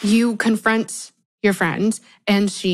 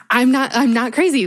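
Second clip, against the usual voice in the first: ordinary talk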